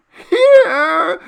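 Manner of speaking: a terrified man tearing out a scream; sharp scream. Acted. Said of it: "he screamed sharply".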